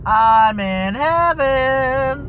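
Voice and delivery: slow, drawn-out swoon, rising high; blissful. Retold or imitated. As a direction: stretch the words out in exaggerated bliss. Acts out own blissful reaction.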